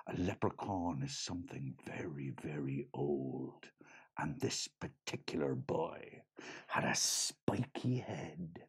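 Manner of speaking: put-on a secret told softly to a child; hushed whisper. A narrator's reading. Teller whispers the leprechaun's mystery.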